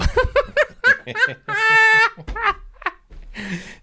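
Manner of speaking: real high cackling laughter; screeching laugh that breaks; wheezing high laugh. Real, not acted. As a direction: crack up hard, nearly crying. Laughing hard at joke.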